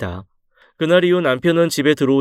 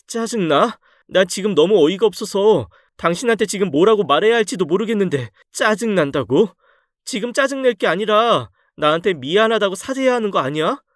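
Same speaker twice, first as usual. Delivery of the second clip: indignant wife; 7 semitones higher than usual. Acted. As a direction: pitch up, outraged and disbelieving. Wife confronts lying husband.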